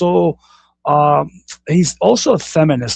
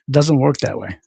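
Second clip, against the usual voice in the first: ordinary delivery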